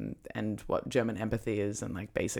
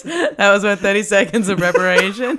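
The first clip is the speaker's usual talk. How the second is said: laughing; 7 semitones higher, 13 dB louder than usual. Real, not acted. Host cracking up at attempt.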